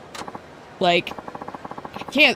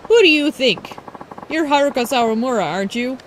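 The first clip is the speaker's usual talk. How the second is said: a hint of a haughty rival idol, higher on the call; bratty idol Ulala; 5 semitones higher than usual. Streamer voicing rival idol Ulala calling out Haruka, lightly haughty. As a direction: snap at the stranger like a spoiled idol.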